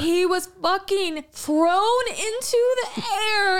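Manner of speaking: high, slow and laughing through it; laughing in disbelief. Real, not acted. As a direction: laugh helplessly at the shock. Retelling shocking crash, laughing.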